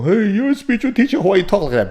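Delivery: high-pitched mock complaint; put-on voice of an affronted follower; offended listener. Follower challenging the spiritual teacher's language, mock indignation.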